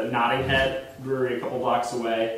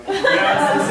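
laughing; 4 dB louder than usual. Retold or imitated. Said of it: "she put me on the phone as [name]".

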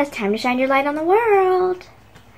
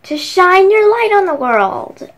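big sign-off; 6 semitones higher, 6 dB louder than usual. Shown as a demonstration. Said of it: "channel sign-off".

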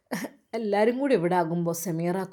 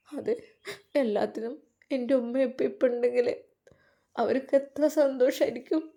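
tearful; 5 semitones higher than usual. Acted. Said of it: "മോളെ കരയല്ലേ".

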